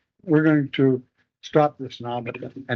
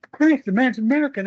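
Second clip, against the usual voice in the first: surprised realisation; 10 semitones higher than usual. Retold or imitated. Realising the prisoner is American.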